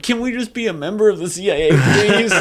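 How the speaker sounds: high, quick playful begging; mock pleading. Acting a plea to the CIA.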